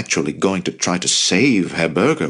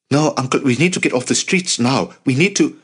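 higher-pitched anxious urging; urgent protest; 7 semitones higher than usual. A narrator's reading. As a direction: press the uncle to flee, voice raised in pitch. Nephew urgently begs uncle to leave.

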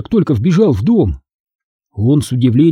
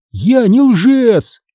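high, very slow, stretched cry; shouted protest; 9 semitones higher, much slower than usual. A narrator's reading. Boy cries from the stairs at parents, shouted protest. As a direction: call out indignantly, volume restrained. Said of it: "крикнул я с лестницы".

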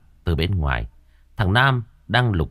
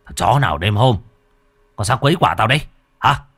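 louder, fast furious yelling; angry shout; 4 semitones higher, 6 dB louder, faster than usual. A narrator's reading. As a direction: snarl threats through the door. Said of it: "Gã bực mình quát".

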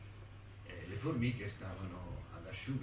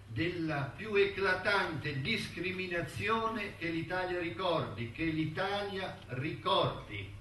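raised, slow declamatory voice; emphatic proclamation; 8 semitones higher than usual. Acted. Friends declare him victim of a notorious injustice, emphatic proclamation. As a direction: proclaim it grandly, hammering the repeated phrase.

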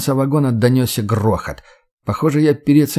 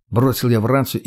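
usual speaking voice

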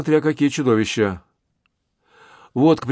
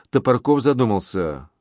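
ordinary delivery

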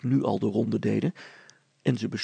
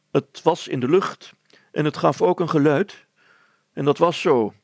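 firm schoolmaster; 3 semitones higher, 7 dB louder than usual. Acted. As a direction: state it like a teacher. Teacher Klevenaar states.

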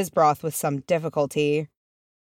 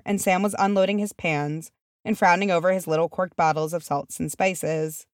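ordinary delivery

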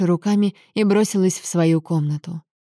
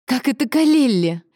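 high, incredulous female voice; high-pitched disbelieving cry; shocked exclamation; 7 semitones higher than usual. A narrator's reading. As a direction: echo the name in dismay. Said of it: "воскликнула Аньезе".

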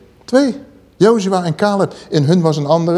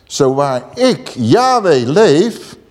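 solemn oath; much slower than usual. Acted. Quotes God's oath.

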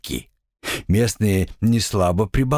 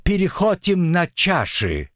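put-on drunk devil voice; 7 semitones higher than usual.

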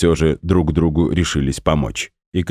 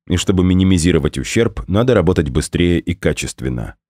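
plain reading voice

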